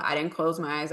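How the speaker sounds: ordinary delivery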